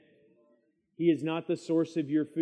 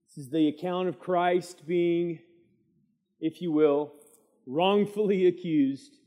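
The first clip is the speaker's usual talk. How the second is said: ordinary talk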